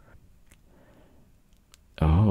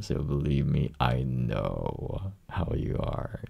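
normal talking voice

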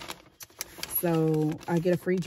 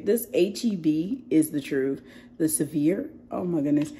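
usual speaking voice

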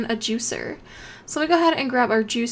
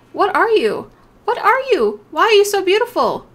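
high, loud delighted questions; excited; 7 semitones higher, 6 dB louder than usual. Real, not acted. To the beautiful new animal, high loud thrilled gushing. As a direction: gush over it, thrilled.

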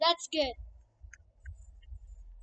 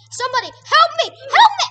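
rising, louder and higher; panicked screaming; 7 semitones higher, 11 dB louder, faster than usual. Real, not acted. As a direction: build from plea to scream. Begging for help, panicking.

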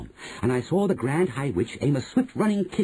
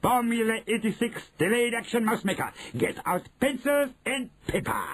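shouting witch; 11 semitones higher than usual. A narrator's reading. Grand High Witch dictating a recipe, high shout. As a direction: proclaim the formula at high pitch. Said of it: "Grand High Witch was shouting".

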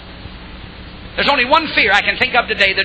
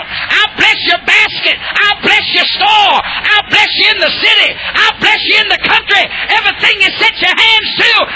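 higher and louder, hammering each blessing; preaching at full force; 8 semitones higher, 3 dB louder than usual. Acted. Preacher thundering God's blessings at the congregation, full force. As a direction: preach God's promises with rising fire.

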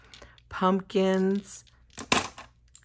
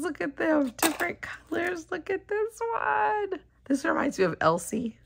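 ordinary delivery

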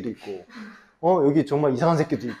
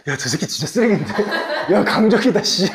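laughing; 5 semitones higher, 3 dB louder than usual. Real, not acted. Student's comeback makes teacher laugh.

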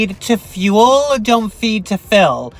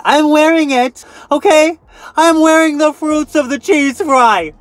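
mock-proud confession; 7 semitones higher, 3 dB louder than usual. Owning her breakout.

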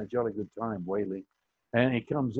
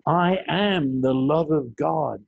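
slow declaration; 4 dB louder, slower than usual. Acted. Affirmation declared slowly.

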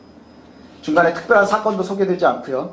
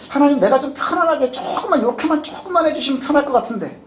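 higher-pitched coaxing plea, acted; wheedling; 7 semitones higher than usual. Believer bargaining with God for an easier way, wheedling. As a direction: wheedle like a child pestering for a small favour. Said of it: "마치 떼쓰는 아이들처럼".

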